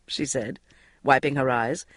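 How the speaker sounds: plain reading voice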